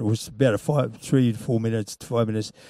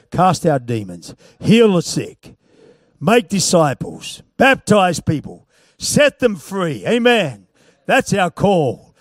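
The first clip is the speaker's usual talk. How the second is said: high, loud, slow preacher's cry; fiery revival preaching; preaching at full force; 6 semitones higher, 6 dB louder, slower than usual. Acted. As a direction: proclaim each command like a rally call. Preaching climax list.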